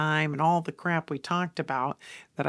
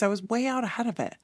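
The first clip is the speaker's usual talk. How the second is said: ordinary delivery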